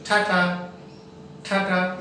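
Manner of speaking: "ठाठा" neither hard ठ nor plain ट; slow, soft t-haa t-haa for "ठाठा"; soft aspirated T. Shown as a demonstration. Teacher repeats the model for students, soft aspiration. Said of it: "ना तो hard बोलना है".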